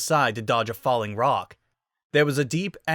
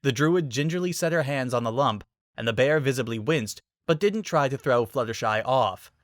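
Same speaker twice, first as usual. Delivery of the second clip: usual speaking voice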